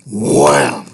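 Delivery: low, loud wham; crash sound effect. A narrator's reading. Narrator voicing a loud crash. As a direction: punch out the crash noise.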